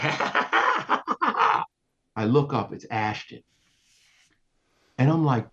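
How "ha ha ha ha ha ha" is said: high-pitched mock cackle; string of high, put-on ha-has; imitated laughing. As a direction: act out someone laughing wildly at you. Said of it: "and is laughing, is like".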